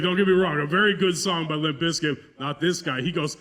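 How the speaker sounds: ordinary talk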